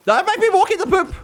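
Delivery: very high, fast, whining plea; mock whiny plea. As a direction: whine like a fussy kid. Pleading with the dog.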